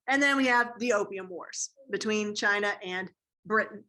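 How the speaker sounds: ordinary delivery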